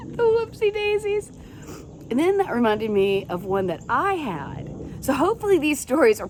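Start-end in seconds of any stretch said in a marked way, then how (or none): 0.1-1.3 high, lilting "The whoopsie daisies"; high playful tag line; singsong catchphrase. Acted. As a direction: lift it up playfully.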